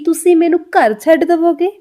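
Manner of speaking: forceful Punjabi. Shown as a demonstration. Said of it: "Punjabi ... थोड़ा ज़ोर से बोली जाती है". (पंजाबी ज़ोर से, ऊँची आवाज़ में)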